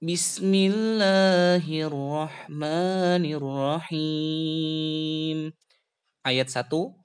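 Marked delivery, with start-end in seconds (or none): 0.0-5.4 measured Quranic recitation. Shown as a demonstration. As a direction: recite it the way it's sung in class. Teacher recites Quran opening.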